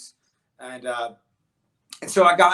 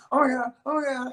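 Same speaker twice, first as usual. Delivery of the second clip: much higher-pitched mimicked chatter; acted-out insistence; 11 semitones higher, 4 dB louder than usual. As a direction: re-enact an excited, high-voiced insistence. Acts out telling the lady.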